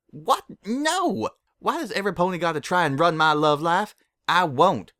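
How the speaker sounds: high, loud, panicked refusal; a frantic farm pony refusing; panicked protest. A narrator's reading. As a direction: protest in alarm, pitch jumping up. Applejack panics.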